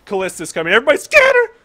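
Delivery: rising to a high, loud yell; mock panic. Enemies fleeing from Callista, acted panicked shout. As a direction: build into a panicked warning cry. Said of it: "they were like".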